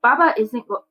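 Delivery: ordinary delivery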